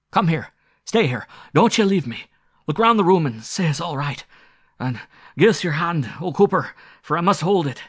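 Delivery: loud, high, urgent plea; frightened pleading. Acted. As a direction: order him close as a panicked squire. Frightened squire begs Cooper to stay.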